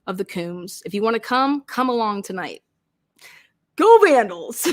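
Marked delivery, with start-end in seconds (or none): 3.8-4.7 high, loud Boston-style fan shout; mock cheer. Imitating a fan's cheer for the livestream. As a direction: yell it like a rowdy fan. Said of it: "He does this".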